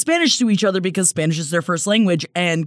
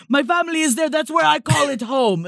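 higher, hammed-up fake accent repeat; exaggerated mock Hilaria accent; 7 semitones higher than usual. Hilaria defending her Spain home, in an exaggerated fake accent. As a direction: push the fake accent further. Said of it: "she's like".

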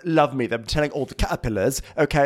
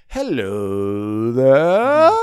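slow, louder swooping hello there; James's hello; 3 semitones lower, 6 dB louder, much slower than usual. Retold or imitated. James greeting Lisa, a slow louder swoopy hello. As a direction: swoop it slowly like a smooth charmer. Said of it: "always does this".

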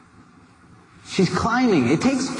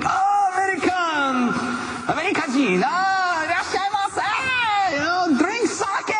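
much higher, shouted eager welcome; excited calling out; 12 semitones higher, slower than usual. Retold or imitated. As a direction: call them in like long-lost friends. Japanese hikers calling to Americans.

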